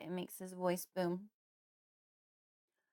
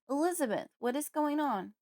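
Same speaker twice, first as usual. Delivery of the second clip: father calls, higher, growing worried; calling out; 7 semitones higher than usual. A narrator's reading. Father calling his silent wife from the living room. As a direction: call out toward the kitchen, anxious. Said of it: "he calls out again".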